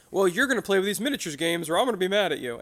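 a bossy parent, mild mock threat; lightly voiced demanding parent; pushy parent voice. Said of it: "be like that parent and go".

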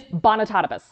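Bonitotibus as bon-a-TOT-a-bus, one smooth word; correct pronunciation. Shown as a demonstration. Host revealing the client's surname, correctly pronounced Bonitotibus. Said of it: "And it is".

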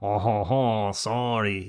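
hammed-up French apology with a chuckled lead-in; mock French. Shown as a demonstration. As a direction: attempt a French-accented apology, badly faked. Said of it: "try to make it a French".

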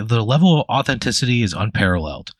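ordinary talk

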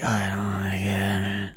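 low, slow whispered groan; mock-feeble, drawn-out whisper; weak patient voice. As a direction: barely whisper it like a wrecked patient. Voicing the bandaged, broken patient.

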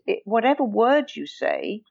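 usual speaking voice

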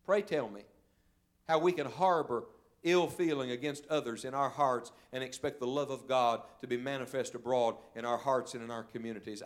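ordinary talk, nothing marked

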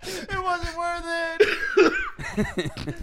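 high, wheezy voice breaking with laughter; helpless laughing. Real, not acted. Hit his head laughing, real breathless laughter. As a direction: squeeze it out through helpless laughter.

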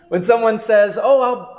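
amused chuckle spilling into the quote; laughing. Real, not acted. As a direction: let a real laugh carry into the line. Laughing while quoting people.

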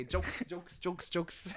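normal talking voice

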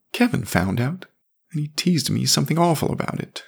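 put-on high, fast boyish complaint; hurt little boy. A narrator's reading. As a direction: tattle like a wounded kid. Jamie complains.

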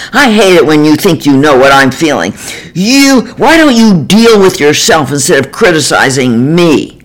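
fast, furious yell throwing the blame back. Retold or imitated. Husband yelling at the therapist, furious and defensive. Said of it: "he yelled".

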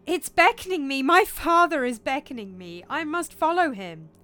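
high, loud, impassioned; fervent cry. Acted. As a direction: insist wildly on following. Hamlet breaks away to follow.

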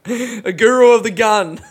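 laughing through the words. Real, not acted. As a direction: crack up while saying it. Laughing at own joke.

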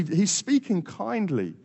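usual speaking voice

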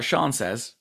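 ordinary delivery

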